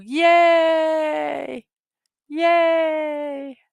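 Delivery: high cheering yay; excited cheer. Real, not acted. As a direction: cheer with real delight. Celebrating the finished puzzle.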